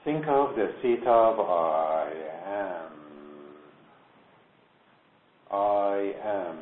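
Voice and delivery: "I am" drawn out slowly, resting on the A of am; slow emphasis on I am. Shown as a demonstration. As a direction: lean into the A of "am", unhurried. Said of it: "Focus on the A of the am".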